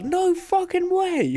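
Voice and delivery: high, slow stunned exclamation; mock astonished comedian reacting to the licence; amazed disbelief. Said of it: "He's like".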